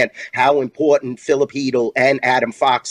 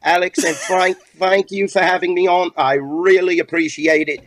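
higher hammed-up British accent, held through the sign-off; 5 semitones higher than usual. Guest signs off to the hosts in a mock British accent. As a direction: play a cheery Brit thanking the hosts. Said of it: "I just wanna hear you talk in a British accent".

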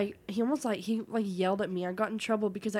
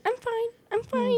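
high, breezy I'm fine; imitating Courtney; 13 semitones higher, 3 dB louder, slower than usual. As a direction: chirp it up high. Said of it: "Courtney's would be like".

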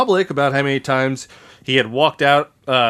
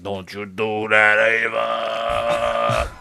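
slow, low, drawn-out plea; hammed-up lovesick pleading; mock pleading; 3 semitones lower, much slower than usual. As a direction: stretch the name into a long mock moan. Acted Frank pleading with Ava.

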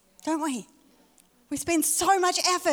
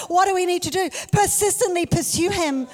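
rising preacher's call, louder and higher; preaching; 6 semitones higher, 7 dB louder than usual. Acted. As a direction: drive it home to the church. Preaching at full force.

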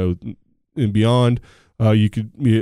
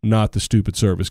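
ordinary delivery

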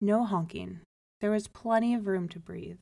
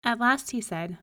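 plain reading voice

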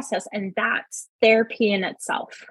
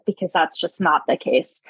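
usual speaking voice

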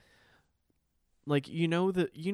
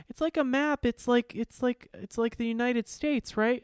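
put-on much higher, louder childlike guessing; mock small child; 12 semitones higher, 5 dB louder than usual. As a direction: play a little girl guessing eagerly. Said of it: "And she's like".

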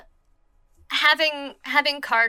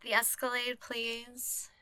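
very soft, small-voiced reply; soft request; 17 dB quieter, slower than usual. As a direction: ask for it very quietly. Acted texted reply, softly.

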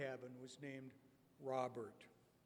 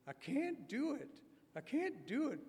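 high, light, shy refusal; a high countertenor begging off; Robert's high voice; 9 semitones higher than usual. Retold or imitated. Preacher voicing shy camper Robert declining, high. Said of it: "Robert responded".

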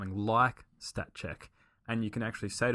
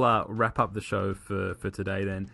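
ordinary talk, nothing marked

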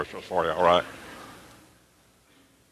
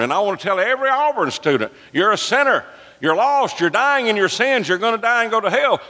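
high, urgent pulpit cry; full-force preaching; 9 semitones higher than usual. Real, not acted. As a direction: build to a high-pitched warning to sinners.

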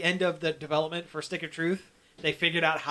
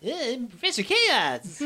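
Professor Chaos voice; 6 semitones higher, slower than usual. Retold or imitated. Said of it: "Butter shows up as Professor Chaos".

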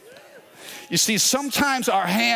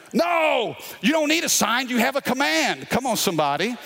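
preaching; 8 semitones higher than usual. Acted. Pastor to congregation, rising preaching force. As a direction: preach it with conviction.

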